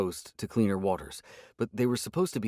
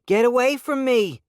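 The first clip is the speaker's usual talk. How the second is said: much higher, louder, panicked scream; terrified scream; 7 dB louder than usual. A narrator's reading. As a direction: scream at attackers in terror. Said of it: "screams grew into words".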